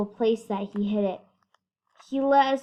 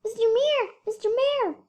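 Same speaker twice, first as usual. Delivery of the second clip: wrong voice; 12 semitones higher than usual. Acted. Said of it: "Sorry, wrong voice".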